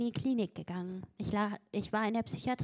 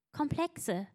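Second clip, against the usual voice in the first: ordinary talk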